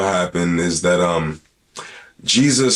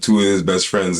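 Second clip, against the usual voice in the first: ordinary delivery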